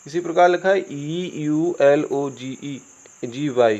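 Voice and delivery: ordinary talk, nothing marked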